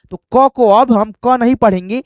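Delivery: ordinary talk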